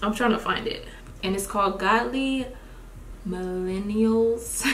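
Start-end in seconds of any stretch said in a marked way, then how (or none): 3.3-4.6 Millennials drawn out slowly, each syllable spaced. Shown as a demonstration. Naming friend's podcast, Millennials said slowly. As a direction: slowly spell out Millennials for viewers announcing the podcast name. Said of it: "I gotta say that word slow".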